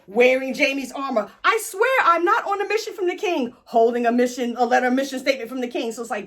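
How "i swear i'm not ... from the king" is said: high, whiny mock protest of innocence; Brienne. Accused knight pleading with her captors, high mock protest. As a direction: squeal the denial like someone caught red-handed.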